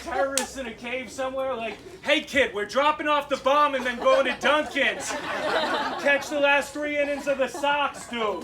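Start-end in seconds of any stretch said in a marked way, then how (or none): 2.0-8.5 loud, hammed-up Boston accent; mock Massachusetts accent, raised and punched out. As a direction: play a terrorist practicing a broad Massachusetts accent. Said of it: "practicing Massachusetts accents".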